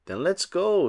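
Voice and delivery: usual speaking voice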